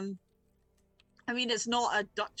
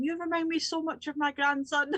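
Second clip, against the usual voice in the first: high-pitched doting granny voice; doting old lady; 8 semitones higher than usual. Acted. Old lady doting on a visitor like her grandson, high voice. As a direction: coo it fondly, pitched well up. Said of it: "she just starts going".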